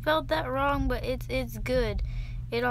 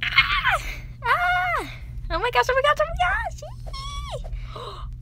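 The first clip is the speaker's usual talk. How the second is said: high shrieking squeals, much louder; excited scream; 5 dB louder than usual. Real, not acted. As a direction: scream with pure joy at the reveal. Shrieking at a rare pet hatch.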